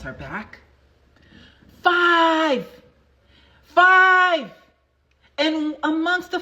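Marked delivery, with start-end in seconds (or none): 1.8-4.5 loud, high exclamation of five; emphatic five. Real, not acted. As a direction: belt out the number with triumph. Announcing the callbacks.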